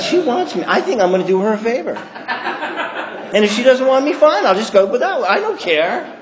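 high, giggling cocky inner voice; laughing inner boast. Retold or imitated. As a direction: talk yourself up through laughter.

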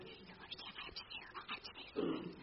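whispered and pressing, under the breath; urgent whisper. Retold or imitated. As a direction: hiss it so the room can't hear. Pleading with her sponsor mid-meeting.

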